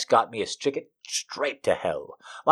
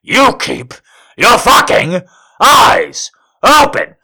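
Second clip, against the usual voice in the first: higher and louder, slow teasing order; mock commanding echo; 10 semitones higher, 8 dB louder, slower than usual. A narrator's reading. Narrator mimics his order back at him, loud teasing. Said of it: "I said".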